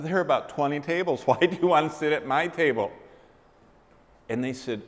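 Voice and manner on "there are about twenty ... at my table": higher and louder, quick, laughing in disbelief; a surprised laugh at the odd request; incredulous inner thought. Retold or imitated.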